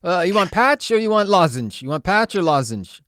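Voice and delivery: put-on high, loud, broken-English hotline woman with a foreign accent. Hotline woman pressing caller to quit, foreign accent, high and loud. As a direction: push the patch fast in a foreign accent. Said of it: "native English speaking from a foreign land".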